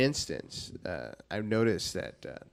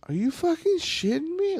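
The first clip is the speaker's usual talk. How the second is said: pitched-up disbelief; high incredulous outburst. Real, not acted. Friend reacting to scared kids, high incredulous yelp. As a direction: squeak out total disbelief.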